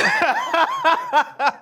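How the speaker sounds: squealing burst of laughter; high shrieking laugh. Real, not acted. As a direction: let out a high-pitched laugh. Laughs at his own retold quote.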